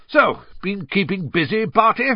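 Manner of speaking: high, loud, breezy small talk. A narrator's reading. Bagman making small talk with Crouch, breezily. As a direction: toss it out breezily, pitch up.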